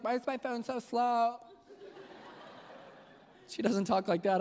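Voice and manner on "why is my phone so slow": lightly put-on whiny wife voice; a hint of a fussy put-on voice; silly wife voice. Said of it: "I don't know why I use that voice".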